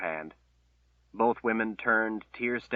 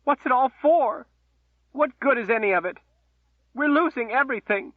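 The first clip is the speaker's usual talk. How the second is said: much higher, louder anguished outcry; distraught cry; 7 dB louder than usual. Acted. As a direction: cry out in despair. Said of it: "she cried".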